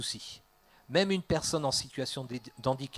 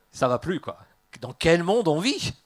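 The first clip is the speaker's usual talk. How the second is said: mock outrage; 4 semitones higher, 9 dB louder than usual. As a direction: lament the state of the world, hammed-up. Acted outrage at teenage rebellion.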